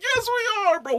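very high mock-tearful agreement; retold overwrought reassurance; verge of tears. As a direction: affirm it as if close to crying. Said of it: "almost the verge of tears".

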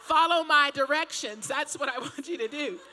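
laughing retort. Retold or imitated. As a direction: push back while cracking up. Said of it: "And I'm like".

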